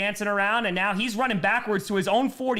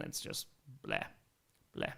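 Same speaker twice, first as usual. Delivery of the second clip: much lower, quiet blah; disgusted blah; 13 dB quieter than usual. Real, not acted. Complaining about the league chasing money, dismissive low blah. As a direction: let it sag in disgust.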